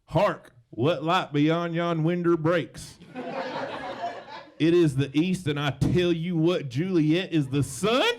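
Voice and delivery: put-on slow, loud Southern accent, theatrically declaimed; Southern Shakespeare. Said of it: "Shakespeare sounds amazing in a Southern accent".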